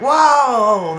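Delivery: a startled, hammed-up whoa; imitated surprise. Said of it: "He's like".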